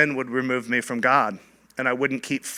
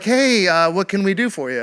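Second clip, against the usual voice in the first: friendly greeting; 6 semitones higher, 4 dB louder than usual. Retold or imitated. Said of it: "'Cause it was like".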